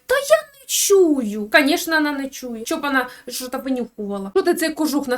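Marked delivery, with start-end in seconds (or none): 0.0-1.5 much higher, very slow put-on voice; wife's dopey, dragged-out excuse; mock lazy reply. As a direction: drawl it out like a clueless wife. Wife answers, voiced by the teller.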